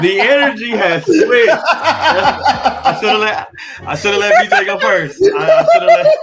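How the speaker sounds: high, loud words through real laughter; slow, helpless, squealing with laughter; laughing hard. Real, not acted. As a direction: barely get it out for laughing. Cracking up at switch in mood.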